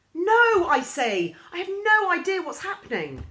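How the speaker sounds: high, loud protest; flustered denial. A narrator's reading. Girl insisting to friends she doesn't know what's happening. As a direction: protest it urgently.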